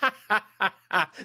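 high staccato cackle; laughter. Real, not acted. Hearty reaction to the host's remark.

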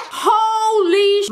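high, slow shocked cry; stunned outburst. Real, not acted. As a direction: gasp it out in disbelief.

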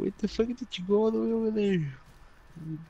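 high, fast, shrill-pitched mock scold; hammed-up high scolding voice; mock scolding family. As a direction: squeal it out like a panicked relative telling the kid off. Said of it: "They're like".

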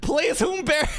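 high, shrill mock begging; pleading damsel. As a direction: beg in a high whiny voice. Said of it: "Raz is gonna be like".

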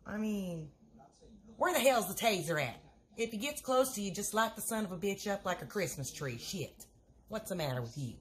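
low-voiced skeptical friend; Helen voice. Second character, Helen.

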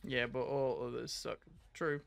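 ordinary delivery

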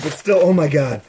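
ordinary delivery

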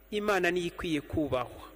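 ordinary delivery

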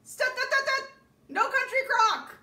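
high, loud "stop" shouted at the husband; playful shout. Real, not acted. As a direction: cut him off with a loud mock-outraged shout.

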